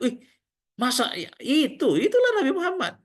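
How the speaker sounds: shocked disbelief. Retold or imitated. Blind man learns the truth.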